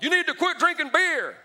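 hammed-up Baptist pastor yelling; preachy pastor. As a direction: bellow it like a pulpit scold. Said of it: "they'll be like".

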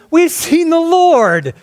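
high and slow, bursting with joyful news; excited announcement. Acted. Disciples telling Thomas they saw the Lord, excited. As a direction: announce it with wonder, pitch way up.

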